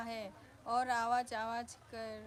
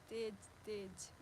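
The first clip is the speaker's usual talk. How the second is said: ordinary delivery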